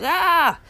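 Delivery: a short, high, loud imitated kid's scream of frustration; mock frustrated scream. Mother imitates her frustrated son's scream for listeners, a short high loud yell. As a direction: let out the boy's frustrated yell. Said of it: "he's so frustrated".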